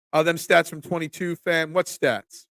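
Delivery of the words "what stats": ordinary delivery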